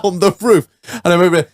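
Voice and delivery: ordinary talk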